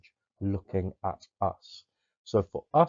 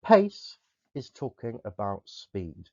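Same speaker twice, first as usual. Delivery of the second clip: ordinary talk, nothing marked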